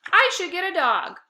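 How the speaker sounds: high, loud eager exclaim. A narrator's reading. Narrator voicing the boy exclaiming to Dad. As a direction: blurt it out like a kid with a plan. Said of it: "I exclaimed".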